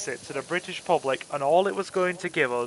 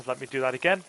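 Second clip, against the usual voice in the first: ordinary delivery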